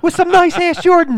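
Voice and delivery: high, loud mock panic; mock yell. Friend joins the game, yelling in a high voice. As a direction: yell it like the girl.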